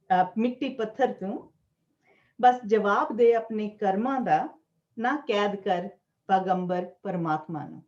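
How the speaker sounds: measured Punjabi verse with lilting line endings; poetry recital. Acted. Poet reading own Punjabi verse to gathering, measured recital. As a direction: declaim each line, pausing between phrases.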